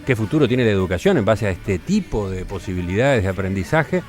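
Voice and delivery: scripted presenter voice with emphatic key words; radio intro read. Acted. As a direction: announce the topic with deliberate pauses and lively pitch swings. (locución de presentación radiofónica)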